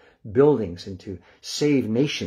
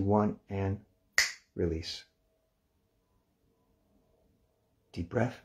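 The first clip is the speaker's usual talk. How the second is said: guided relaxation countdown. Acted. Teacher guiding the class through muscle release, hushed and calm.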